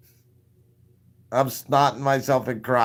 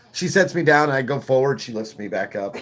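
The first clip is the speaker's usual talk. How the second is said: normal talking voice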